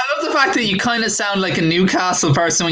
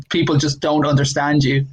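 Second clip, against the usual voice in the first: ordinary talk, nothing marked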